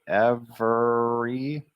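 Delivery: Every stretched slowly, syllables split apart. Shown as a demonstration. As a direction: sound out Every beat by beat. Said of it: "let's do it".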